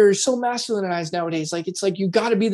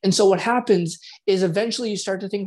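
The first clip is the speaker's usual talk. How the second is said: usual speaking voice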